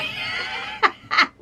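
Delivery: high burst of frustrated laughter; exasperated laugh. Real, not acted. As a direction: laugh it off in despair.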